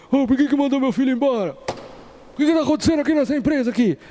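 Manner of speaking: high, fast indignant dad; hammed-up protective father complaining; silly overprotective dad. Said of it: "aí vai o pai bobão lá".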